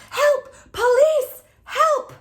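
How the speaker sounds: high, loud cry for help; calling for help. Acted. Bystander shouting for help at the bank. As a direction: yell for the police in panic. Someone calls out at robbery.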